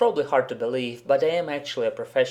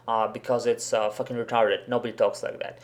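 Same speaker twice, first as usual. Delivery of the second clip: normal talking voice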